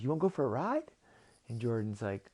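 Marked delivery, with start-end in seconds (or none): 0.0-0.9 higher, rising invitation; talking dolphin. Acted. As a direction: offer it in a lightly pitched-up voice. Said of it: "and the dolphin says".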